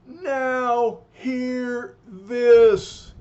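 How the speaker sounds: huge, slow, booming high shout; oversized holler. Acted. Giant Man blasting the fan club with a giant, very loud holler. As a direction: bellow it to knock the fans flat. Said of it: "hollers with his oversized voice".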